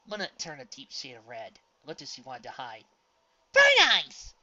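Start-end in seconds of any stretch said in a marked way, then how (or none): 3.5-4.3 loud, high scolding cry of a name; loud indignant snap at a friend; angry snap. A narrator's reading. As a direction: snap at her to stop.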